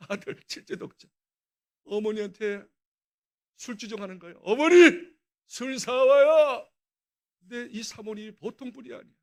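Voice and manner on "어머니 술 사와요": put-on loud drunken demand, dragged out slow; drunken yell. Drunk son yelling at his mother for more liquor, slow loud bellow.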